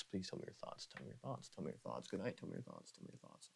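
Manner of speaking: soft whisper fading to almost nothing; ASMR whisper. Real, not acted. Asking viewers for their thoughts, fading ASMR whisper. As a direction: repeat it hushed, dwindling away.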